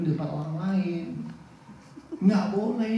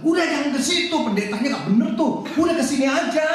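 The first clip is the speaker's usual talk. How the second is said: high, loud insistent urging; urgent poaching pitch; 6 semitones higher, 4 dB louder, faster than usual. Acted. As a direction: lure them away with loud, eager insistence. Mengajak jemaat pindah gereja. (membujuk ngotot dengan suara tinggi)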